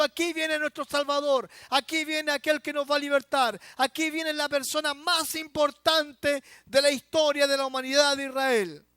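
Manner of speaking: predicación a gritos. Acted. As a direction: proclaim it to the crowd. Predicador a plena voz. (predicación a todo pulmón)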